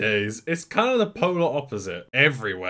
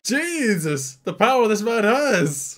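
excited; 7 semitones higher, 5 dB louder than usual. Real, not acted. Excited by the man.

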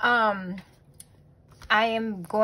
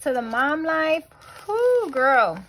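higher, louder hype for the mom life; excited cheer; 6 semitones higher, 4 dB louder, slower than usual. Real, not acted. Vlogger hyping up moms, excited cheer. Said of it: "Like I said".

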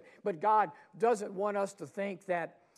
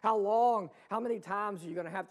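exasperated cry; 4 semitones higher, 6 dB louder, faster than usual. Acted. Preacher voicing Jesus's weary complaint, loud lament.

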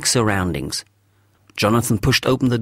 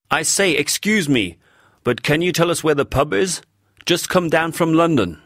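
higher, put-on genteel English accent; affected accent; 7 semitones higher than usual. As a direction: play the London gent asking directions. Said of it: "quite good at affecting accents".

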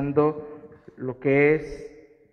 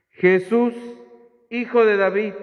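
raised, higher and louder call; crying out; 6 semitones higher, 7 dB louder than usual. A narrator's reading. Blind man calls to Jesus from the roadside, crying out. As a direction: call out to someone passing by. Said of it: "comenzó a gritar".

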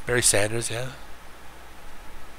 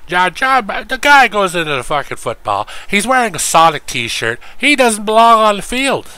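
high, loud hammed-up announcer voice; mock young John Madden; 8 semitones higher, 5 dB louder than usual. Streamer mocking young John Madden, high and loud. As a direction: squeal it out like a goofy young Madden.

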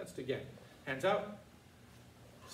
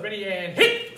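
shouted command; 2 semitones higher, 14 dB louder, slower than usual. Real, not acted. Instructor calls the strike.

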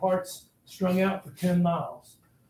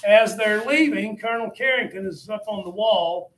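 ordinary delivery

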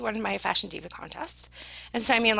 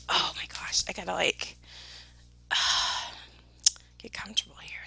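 whispering; 4 semitones lower, much faster than usual. Real, not acted. Aside while settling in.

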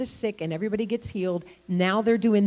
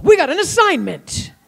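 louder, higher eager boast; excited announcement; 7 semitones higher, 7 dB louder than usual. Retold or imitated. Boy telling his friends about their task, eager and loud. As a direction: announce it like a thrilling mission.

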